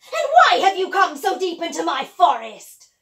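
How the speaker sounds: loud demanding witch. Acted. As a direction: boom it like a witch. Witch questions the girl.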